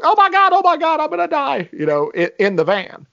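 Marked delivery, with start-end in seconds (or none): 0.0-1.7 high, fast hammed-up terror; mock scream. Imitating MacGruber in the van under fire, mock terror. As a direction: squeal it like he's dying, half joking. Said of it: "screaming bloody murder".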